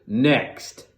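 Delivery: curt headmaster's summons; snapped command. A narrator's reading. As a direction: snap for the following boy. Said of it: "snapped Mister Coombes".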